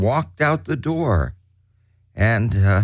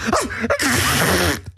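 big acted sneeze; mock sneeze; 4 semitones higher than usual. A narrator's reading. Narrator imitating the assistant chef, comic sneeze. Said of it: "started to sneeze".

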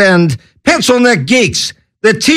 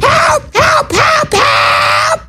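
very high, screaming hammed-up help; mock cry for help; much slower than usual. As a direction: shriek for help like a madman in a fit. Mock lunatic crying for help.

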